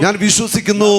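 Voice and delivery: high, loud declaratory cry; full-force prayer. Real, not acted. Preacher leads congregation line by line, loud fervent proclamation. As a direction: call it out strongly for the crowd to echo.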